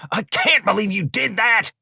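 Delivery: high-pitched angry disbelief; incensed, squabbling robber; exasperated scolding. Acted. Carl scolding Jamie in a quarrel.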